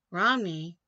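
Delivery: a sudden loud name; loud outburst. Real, not acted. Blurting the name as he starts reading the story. Reading news aloud, bursts out.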